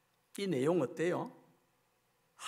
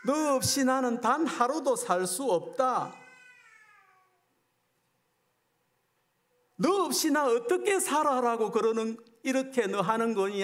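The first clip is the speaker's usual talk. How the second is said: anguished plea; 6 semitones higher, 3 dB louder than usual. Acted. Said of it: "하는 이 하나님의 마음이".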